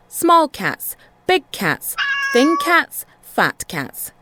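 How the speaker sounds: sing-song storyteller list; playful list. A narrator's reading. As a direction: paint each cat with the voice. Contrasting cat list.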